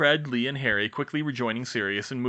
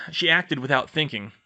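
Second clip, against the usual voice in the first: ordinary delivery, nothing marked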